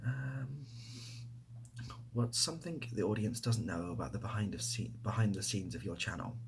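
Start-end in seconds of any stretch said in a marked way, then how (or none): none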